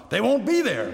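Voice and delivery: high-pitched, exasperated comeback; exasperated retort. A narrator's reading. Husband answers wife's alarmed cry.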